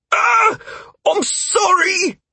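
high-pitched cry of apology; yelp, then a drawn-out shouted sorry; alarmed apology. Acted. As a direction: shout it out high and slow, horrified at the slip. Militiaman hits his partner by mistake.

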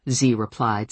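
plain reading voice

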